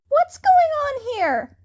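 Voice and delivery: put-on high, loud young boy calling out; a boy stepping in to stop bullies; young male voice. A narrator's reading. Newcomer calls out to the bullies, high and loud. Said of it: "said a young male-sounding voice".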